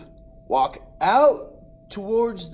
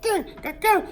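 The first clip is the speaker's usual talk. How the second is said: much higher squawking gah; rooster squawks; faster than usual. Retold or imitated. The rooster squawks while juking around, much higher. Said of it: "The Terminator's like".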